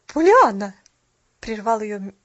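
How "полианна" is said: loud, cold reprimand; icy interruption. A narrator's reading. Mrs. Carew interrupting the child, icy and loud. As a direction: cut in sternly with the name. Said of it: "ледяным тоном".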